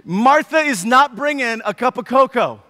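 normal talking voice

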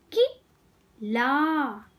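कि, then a pause, then a drawn-out ला; syllable by syllable. Shown as a demonstration. Teacher teaching kids to read, syllables apart. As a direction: break it as कि - ला. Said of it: "शब्द पढ़ना सिखाऊंगी".

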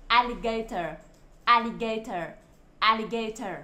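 "Alligator" with the opening "A" clearer and stronger; stress on first syllable. Shown as a demonstration. Teacher drilling word stress with children, "alligator" stressed on its first syllable. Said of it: "đọc rõ hơn và mạnh hơn".

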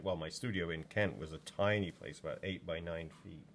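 ordinary talk

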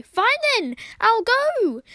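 the cat voiced by the player, leaving offended; huffy mock exit. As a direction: flounce off in a pretend huff.

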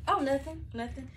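high, sugary fake innocence; fake innocent. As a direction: play it sweet and innocent. Feigning innocence to the boss.

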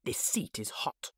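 hushed whisper. Acted. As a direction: whisper it secretly. Hook whispers to Smee.

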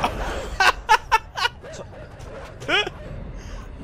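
high wheezing laugh. Real, not acted. Laughing at the game.

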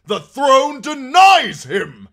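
high, slow, full-force shout; defiant cry. A narrator's reading. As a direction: cry it out as a denunciation. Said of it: "he cried".

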